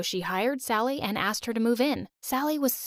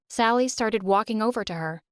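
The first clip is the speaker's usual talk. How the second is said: usual speaking voice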